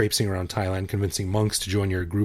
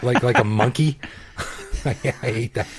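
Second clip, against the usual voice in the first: ordinary talk, nothing marked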